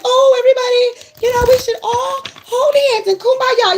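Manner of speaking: high mocking kumbaya voice; mock sweet nice voice. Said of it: "I be on here being all nice".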